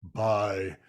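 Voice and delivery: low, slow pompous ad voice; Orson Welles parody. Retold or imitated. Low slow Orson Welles voice selling nuggets. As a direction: intone the ad with mock gravity. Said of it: "a little Orson Welles joke".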